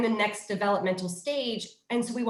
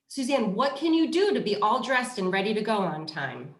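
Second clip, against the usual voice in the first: ordinary delivery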